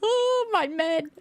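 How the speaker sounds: high, drawn-out laughing wail; keen theatrically in long high notes, half laughing; mock wail. Player mourns men at the gravestones, high mock wail.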